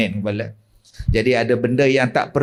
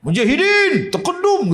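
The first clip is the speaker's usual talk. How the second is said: 1 mocking high jin voice; 12 semitones higher than usual. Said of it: "kata dia".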